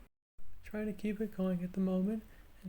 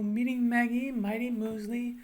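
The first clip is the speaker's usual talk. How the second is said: higher, louder sustained drill phrase; a bit higher; 7 semitones higher, 6 dB louder than usual. Shown as a demonstration. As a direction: push it up and out, holding the tone. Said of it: "go a little bit higher and go".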